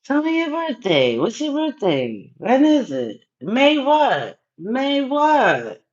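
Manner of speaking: high, insistent mock interrogation; playful mobster pressing; pushy gangster demand. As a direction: lean on them for an answer. Pressing viewer for birthday.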